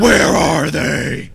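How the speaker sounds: slow, gravelly Batman impression. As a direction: growl it out slowly like Batman. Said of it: "Anytime someone says Batman".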